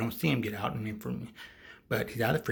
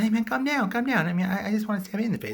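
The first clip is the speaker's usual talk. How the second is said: mock calming; 11 semitones higher, 5 dB louder than usual. Killer calming the panicked guy.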